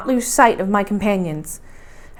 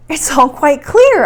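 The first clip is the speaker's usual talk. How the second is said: higher, louder and slower, thrilled; excited exclamation; 4 semitones higher, 5 dB louder, slower than usual. Acted. Axel exclaiming at the rocks in the gallery, thrilled and loud. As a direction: blurt it out with sudden delight. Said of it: "I exclaimed".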